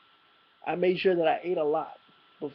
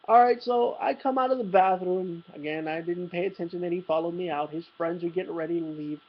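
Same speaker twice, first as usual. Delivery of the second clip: usual speaking voice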